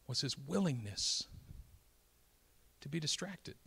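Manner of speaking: soft refrain. Acted. Preacher softly repeating his point to the congregation, hushed. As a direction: let it drop to a near hush.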